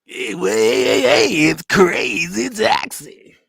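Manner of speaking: high, loud, drawn-out game announcer call; hyped game shout. Retold or imitated. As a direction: stretch it out like an arcade game announcer. Mimics the game's opening shout.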